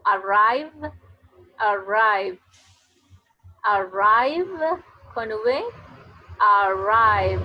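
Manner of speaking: "arrive" said loud and slow, with the v held; model English word. Shown as a demonstration. Teacher drilling "arrive" for students, loud and slow. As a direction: buzz the v at the end of "arrive" again and again. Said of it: "Suena como D".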